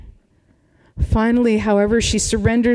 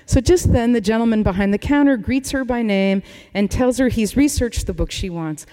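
ordinary talk, nothing marked

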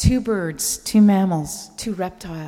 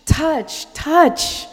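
high, loud, insistent repeated call; eager yelling; 9 semitones higher, 8 dB louder, much slower than usual. Retold or imitated. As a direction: shout it like an excited boy grabbing for a pet.

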